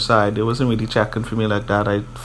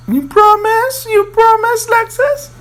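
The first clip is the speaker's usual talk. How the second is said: very high, slow mock whine; snotty pleading; 3 dB louder than usual. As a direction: imitate her tearful begging in a squeaky falsetto. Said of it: "she was all snotty".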